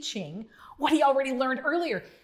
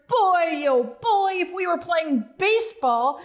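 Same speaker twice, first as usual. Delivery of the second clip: higher, louder happy cheer; 5 semitones higher, 3 dB louder than usual. Acted. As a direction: cheer like a proud little frog. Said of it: "as Bonzo cheered".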